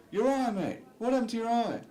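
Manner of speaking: high, loud alarmed stranger; high, loud worried stranger; loud concern. Retold or imitated. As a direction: shout it out, worried about the eye. Stranger shouting about his eye.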